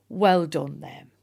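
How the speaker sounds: slow, dry, sarcastic well done. Real, not acted. Sarcastic praise of Victorians.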